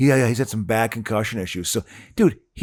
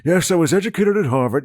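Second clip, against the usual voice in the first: put-on higher posh lockjaw accent, jaw held tight; 7 semitones higher, 3 dB louder than usual. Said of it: "continental, like, lockjaw accent".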